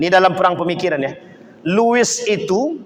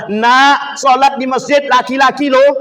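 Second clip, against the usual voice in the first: put-on calling to child; 6 semitones higher, 4 dB louder than usual. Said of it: "kata kita kepada anak".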